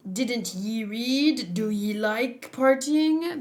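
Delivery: put-on slow pirate accent saying ye; mock the pirate accent, drawling ye; light pirate accent on ye; pirate accent imitation. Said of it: "Why is he sounding like ye?".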